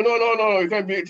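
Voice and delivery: high, loud frantic no; panicked refusal. Acted. Dead man refusing his bad deeds, loud panicked cry. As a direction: reject him in a panic.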